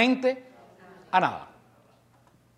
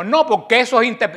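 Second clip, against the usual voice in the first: higher and louder, a lightly mocking take on the visitor; mocking quote; 6 semitones higher, 6 dB louder than usual. Retelling the Adventist neighbour's objection, lightly mocking. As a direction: toss back the Adventist's line with a hint of scorn.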